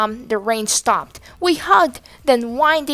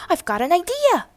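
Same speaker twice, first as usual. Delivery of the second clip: squeak; 7 semitones higher than usual. A narrator's reading. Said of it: "he squeaked in my ear".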